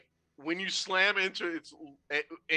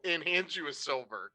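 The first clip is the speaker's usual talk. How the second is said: ordinary talk, nothing marked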